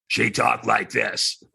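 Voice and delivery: a hint of a slow, raspy chain-smoker. Shown as a demonstration. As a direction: lightly rasp it out like an old smoker. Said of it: "that's why her voice was so raspy".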